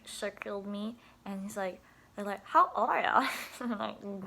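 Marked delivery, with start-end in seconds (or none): 2.5-3.3 put-on higher, much louder kid question; curious kids. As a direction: shout it like a crowd of kids. Kids circling her asking.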